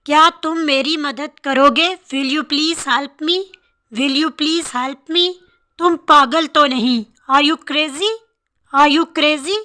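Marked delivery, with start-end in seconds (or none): none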